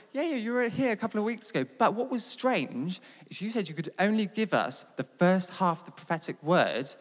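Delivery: imitating someone keenly jogging your memory; retold eager reminder. Leader's wife reminds him, retold.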